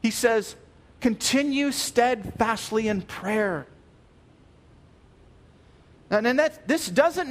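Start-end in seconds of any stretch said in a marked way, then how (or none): 1.0-3.7 slow, weighty "Continue steadfastly in prayer" with "continue" stressed; deliberate slow emphasis on "continue"; strong command. Shown as a demonstration. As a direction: lay each word down firmly. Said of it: "That's very strong".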